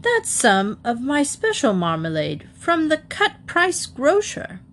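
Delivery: high-pitched, affronted protest; offended, high-voiced objection; indignant protest. A narrator's reading. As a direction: protest hotly, pitch climbing to the end. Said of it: "he exclaimed hotly".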